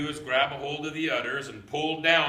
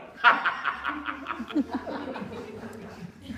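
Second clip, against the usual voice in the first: hearty laugh; 8 semitones higher than usual. Acted. Grandpa laughs at his plan.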